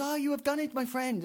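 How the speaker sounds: put-on high Belgian-French accent; gushing Belgian detective; Poirot. Poirot flattering his sidekick, high Belgian accent. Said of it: "he goes".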